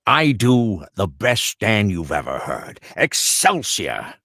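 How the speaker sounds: slow Stan Lee impression. Shown as a demonstration. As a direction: boom out Excelsior like Stan. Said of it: "You do a Stan quickly?".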